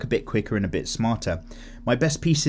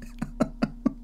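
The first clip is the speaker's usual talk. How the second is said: breathy whispered laugh; 7 dB quieter than usual. Real, not acted. Laughs after reading comment.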